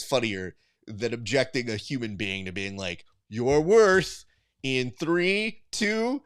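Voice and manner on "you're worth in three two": raised, drawn-out countdown into a loud verdict; hammed-up emcee delivering the crowd's judgment; mock contest host. Host rejecting a contestant by applause, showy mock verdict.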